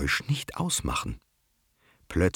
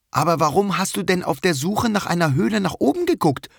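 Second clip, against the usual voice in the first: astonished question; 14 semitones higher, 5 dB louder than usual. Acted. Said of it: "fragt Mücke erstaunt".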